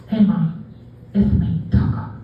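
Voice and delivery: a lightly lisped dog leaving a message; lisping dog voice. Retold or imitated. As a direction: play the dog calling mom, slight lisp. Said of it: "my dog has a lisp".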